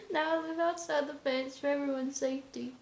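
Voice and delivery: high-pitched, just out of a laugh; real amusement lifting the voice high; amused repeat. Real, not acted. Repeats the line after laughing.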